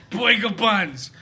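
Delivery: slow, drawn-out mock New York accent; drunk mom accent. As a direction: drawl Boiga buns slowly like the drunk mom. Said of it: "She's like".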